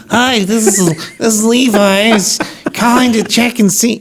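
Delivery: mock your own phone voice as a nerd; nerdy voicemail voice. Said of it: "I'm like, I sound like a nerd".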